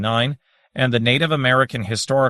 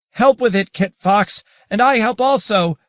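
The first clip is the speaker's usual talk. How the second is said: much higher, louder yowling plea; 10 semitones higher, 5 dB louder than usual. A narrator's reading. Daytorch calling Kit Fox to help, loud yowl. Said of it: "Daytorch yowled".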